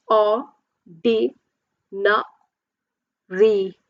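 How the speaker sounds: "O, D, na, re" as four spaced beats; syllable by syllable. Shown as a demonstration. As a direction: sound out each syllable separately, O, D, na, re.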